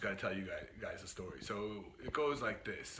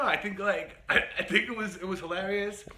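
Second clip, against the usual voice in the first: amused, higher and louder through laughter; laughing; 7 semitones higher, 7 dB louder than usual. Real, not acted. Storyteller looking back on the ride as hilarious, laughing.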